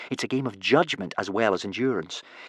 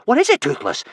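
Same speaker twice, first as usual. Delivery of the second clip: high, louder alarmed question; gasped; 13 semitones higher, 6 dB louder than usual. Acted. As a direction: gasp it out, startled. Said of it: "gasped Hiccup".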